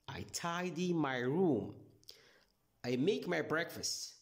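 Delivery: ordinary talk, nothing marked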